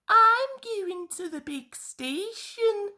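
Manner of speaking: high, bright little engine voice; a cheery young engine voice, pitched high; Thomas the engine. A narrator's reading. Thomas tells Percy and Toby his plan, high engine voice. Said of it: "he said to Percy and Toby".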